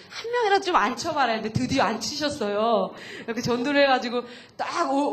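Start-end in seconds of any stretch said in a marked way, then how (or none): 0.1-1.3 higher nudge. Retold or imitated. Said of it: "이런 말씀에".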